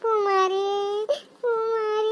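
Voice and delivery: put-on a mother mourning her drowned child; grieving mother. Mother refuses to leave, weeping.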